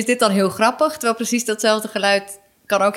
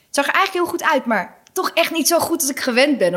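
nagespeelde collega; 6 semitones higher than usual. Retold or imitated. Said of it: "een van de programmeurs gezegd van".

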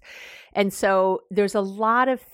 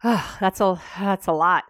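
put-on commiserate with the kid; empathetic. Shown as a demonstration. Parent matching a stressed teen's emotion, sympathetic. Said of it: "So you might say".

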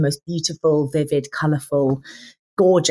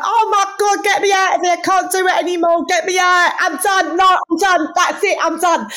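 high, loud, shrieking mock panic; panicked Gemma Collins; 4 dB louder than usual. Actress imitates Gemma Collins at a horror film, loud high shriek. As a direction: squeal like a terrified horror-film guest. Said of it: "She'd be like".

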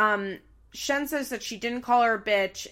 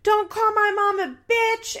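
high, loud mock angry shout; angry outburst; 12 semitones higher, 5 dB louder than usual. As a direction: yell it in defence of your mom. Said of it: "which really sets Bar off".

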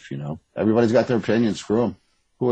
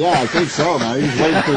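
laughing it out, higher and louder; words bursting through real laughter; 8 semitones higher, 7 dB louder than usual. Real, not acted. Laughing at his own joke.

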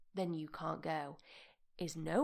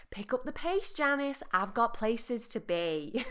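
louder, higher mock impatience; impatient driver; 4 semitones higher, 6 dB louder than usual. Driver telling Janice to get moving, mock impatience. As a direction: hurry them along, loud and exasperated.

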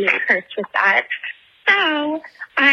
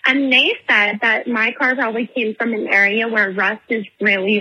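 ordinary delivery

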